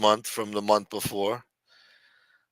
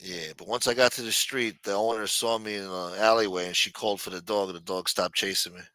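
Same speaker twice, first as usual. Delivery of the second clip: usual speaking voice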